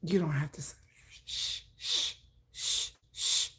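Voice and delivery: soft whisper, hushing someone; whispered shushing. Retold or imitated. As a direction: whisper to make her be quiet. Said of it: "I get shushed".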